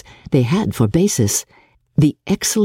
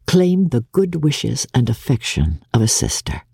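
plain reading voice

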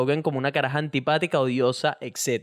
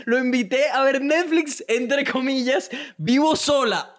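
mocking quote; 8 semitones higher, slower than usual. Reads letter in mocking voice.

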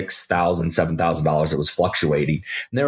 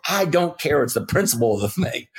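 mock indignation; 7 semitones higher than usual. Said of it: "he just was furious".

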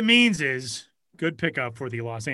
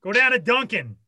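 high, hammed-up Boston bossing; Boston accent; 7 semitones higher than usual. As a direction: play a pushy Boston cousin. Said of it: "trying to do, like, Casey Affleck and S N L".

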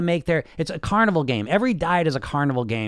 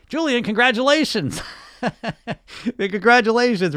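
higher, laughing mock praise; laughing sarcastic congratulations; 6 semitones higher, 4 dB louder than usual. Real, not acted. Teasing a listener who wrote in, laughing mock congratulations. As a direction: tease him through a laugh. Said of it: "I'm laughing".

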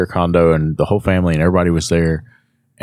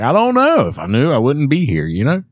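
high-pitched exasperated protest, acted out; mock-frantic, starting very high and easing slightly; retold exasperation; 8 semitones higher than usual. Patient answering the nurse's question, comically exasperated.

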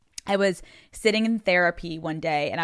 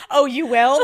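higher, louder teasing question; mock surprise; 6 semitones higher, 8 dB louder than usual. Boyfriend answering her marriage announcement, mock-surprised. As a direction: imitate a boyfriend playfully taken aback.

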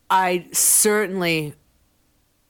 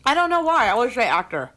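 talking with a mouthful of food; words round a bite of food; mouth full; 7 semitones higher, 4 dB louder, faster than usual. Real, not acted. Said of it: "Now I have food in my mouth".

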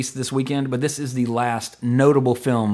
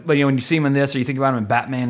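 ordinary talk, nothing marked

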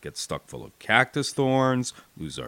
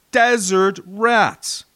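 drawn out, slow and louder, a radio taunt; mocking nickname; 8 semitones higher, 6 dB louder, much slower than usual. Berlin Betty taunting paratroopers on the radio, slow mocking announcement. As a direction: savor the name slowly like a sneering broadcaster. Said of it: "she began calling us".

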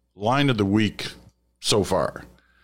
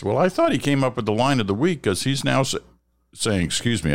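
hiccups; 7 semitones higher than usual. Real, not acted. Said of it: "I've got the hiccups here today".

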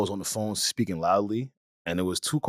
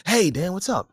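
code-switched office voice; 8 semitones higher than usual. Retold or imitated. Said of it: "my code switch is instantaneous".

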